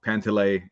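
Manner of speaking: Pantile said as PAN-tuh-lay; mispronounced surname. Shown as a demonstration. Guest listing versions of his name, Pantile said PAN-tuh-lay. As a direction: give Pantile a weak middle syllable before "lay". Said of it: "Nobody actually knows".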